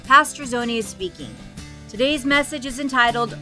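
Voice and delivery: ordinary delivery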